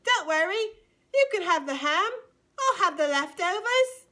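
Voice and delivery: high, loud mock-British urchin; bad British accent. Tiny Tim giving up the ham for leftovers, bad British accent. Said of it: "doing that British accent".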